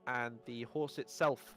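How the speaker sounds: ordinary talk, nothing marked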